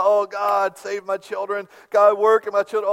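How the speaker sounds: loud pleading prayer; imitating mom's fervent cry. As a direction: cry out to God for the children. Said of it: "crying out".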